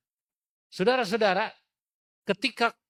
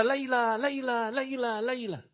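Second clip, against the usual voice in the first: calling out, fading; 7 semitones higher, slower than usual. Acted.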